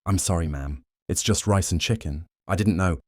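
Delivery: ordinary delivery, nothing marked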